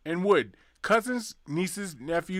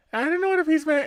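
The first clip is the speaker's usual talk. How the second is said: high squeaky voice; 12 semitones higher than usual. Acted. Joke, resident voice.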